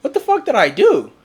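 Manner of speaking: high, loud, baffled outburst; shocked. Retold or imitated. Retelling his confusion on hearing what friend said, loud and high. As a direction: thrown by what he'd done. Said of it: "I'm like".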